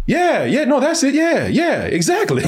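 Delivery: high, eager mock agreement; hammed-up relieved agreement; Don Cheadle. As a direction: jump on the excuse gratefully, ending in a laugh. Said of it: "Don Cheadle's like".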